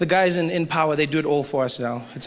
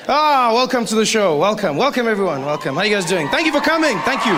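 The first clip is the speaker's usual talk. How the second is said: higher, louder gushing greeting; overexcited welcome; 6 semitones higher, 4 dB louder than usual. Acted. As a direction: gush at the crowd like a star. Overexcited mock welcome to crowd.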